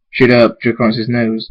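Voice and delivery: ordinary delivery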